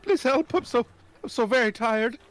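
high, slow, loud begging; desperate pleading. Acted. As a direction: plead for help, high and drawn out. Exhausted youths begging for help.

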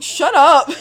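sharp loud "shut up" yell; shouting. Retold or imitated. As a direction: snap it out, loud and high. Retold yelling at someone.

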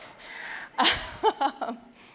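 big laugh trailing down. Real, not acted. Joking to the audience about crying, laughing. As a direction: laugh at your own tearfulness.

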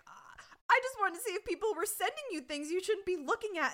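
high, mock defensive whine of excuses; hammed-up flustered self-justifying; defensive dad. Dad excusing his snooping to daughter, high mock defensiveness.

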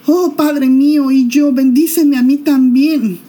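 clamor alto, fuerte y lento; grito amargo. Acted. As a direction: suplica gritando con amargura. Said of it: "lanzó un grito tan fuerte y lleno de amargura". (hijo engañado clama al padre con grito amargo)

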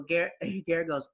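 usual speaking voice

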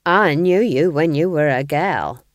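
slow, loud, sullen dig. A narrator's reading. Nanny needles Granny about her girlhood, sullenly. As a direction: drag it out with a grudge. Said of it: "said Nanny sullenly".

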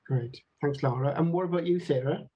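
normal talking voice

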